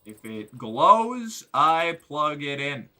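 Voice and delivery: slow deliberate rule; slow mock-solemn rule. Real, not acted. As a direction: declare it like a motto. Stating game rule.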